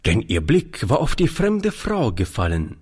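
plain reading voice

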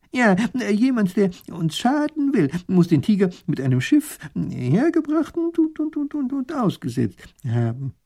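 high, halting scholar; agitated, high-pitched professor stumbling along; stammering professor. A narrator's reading. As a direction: stutter and get stuck on a word.

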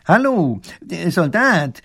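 „der Soldat!“ langsam und gedehnt gerufen, höher; rufend. Acted. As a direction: ruft dem Soldaten von weitem zu. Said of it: "spricht Professor Pfiffikus ihn an". („Soldat“ laut gedehnt gerufen)

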